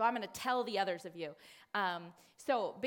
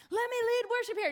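mock celebrity; 12 semitones higher, 6 dB louder, faster than usual. Mocking a celebrity worship leader.

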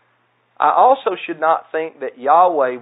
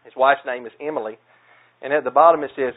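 ordinary talk

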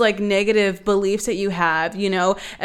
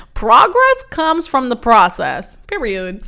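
louder, proud catchphrase read; slogan delivery; 6 dB louder than usual. Shown as a demonstration. Host proclaims her new motto to listeners, louder and proud. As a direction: announce it like a t-shirt slogan. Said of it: "let's put that on a t-shirt".

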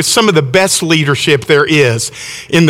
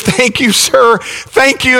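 higher-pitched, lightly acted gratitude; mildly gushing thank-you; retold gushing thanks; 7 semitones higher, slower than usual. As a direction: imitate a relieved mother thanking her rescuer. Relieved mother thanks her helper.

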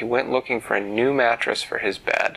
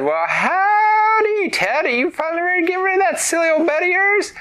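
very high, loud country voice; high folksy shopkeeper; 5 dB louder than usual. Acted. Root and Rod, folksy shopkeeper.

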